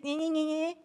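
high, mocking "nye-nye" whinge; whining child. Mimicking kids pestering mommy, whining child. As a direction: imitate kids nagging in a high nonsense whine. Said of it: "Mommy," when our kids".

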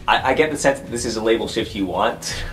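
usual speaking voice

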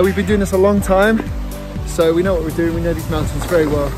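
ordinary delivery

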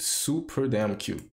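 usual speaking voice

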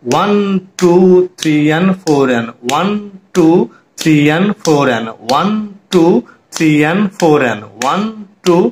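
slow, drawn-out count with "and" as "an"; correct rhythmic counting. Shown as a demonstration. Teacher demonstrates counting with off-beats, slow rhythmic counting. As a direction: stretch each beat evenly, flowing into the next. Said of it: "এটাকে সঠিকভাবে count করতে হবে".

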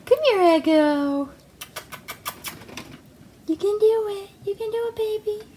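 high cheering pet voice. Real, not acted. Owner cheering her rat on, high encouraging baby talk.

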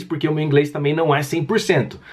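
ordinary talk

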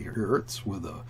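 usual speaking voice

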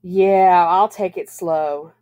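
drawn-out, grudging agreement with a loud start; grumbling. A narrator's reading. Bobby gives in to his mother, grumbling. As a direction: drag it out in a mild grumble. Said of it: "Bobby grumbled".

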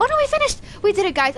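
high, loud burst of joy; elated squeal. Real, not acted. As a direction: squeal with relief at winning. Sudden victory.

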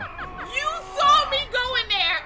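outraged accusation. Real, not acted. Blaming teammate after being caught.

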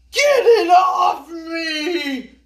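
slow, loud panicked scream; frightened shriek. Acted. Mister Ao Yang panics at the snake on his arm, shrieking. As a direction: shriek in terror as the snake grips. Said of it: "he shrieked".

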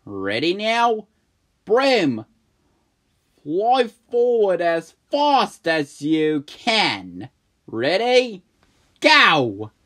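loud, slow, high orders; excited command. Acted. May ordering the broom to full speed, loud. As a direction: rev up the broom.

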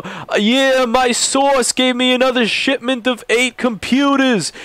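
slow, high mock Italian-American mobster voice; a hammed-up Italian-American Soprano accent; Italian Guido accent. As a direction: drag it out like a Soprano wiseguy. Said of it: "using an Italian, like, Guido Soprano's accent".

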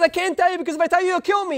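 high, rushed protest; acted frantic excuse; retold fearful refusal. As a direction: blurt it out fast and high, as if pleading. Retold frightened refusal to Napoleon.

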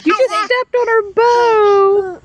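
high startled cry; sudden high alarm; surprised. Real, not acted. Sudden alarm about the bow.

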